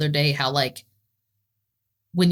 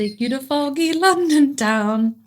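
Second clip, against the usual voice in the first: higher, slow, drawn-out mock English accent; 8 semitones higher than usual. Riffing on foggy London with co-host, playful fake English accent. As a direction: put on a hammed-up English accent. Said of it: "trying to think of my Spice Girls accent".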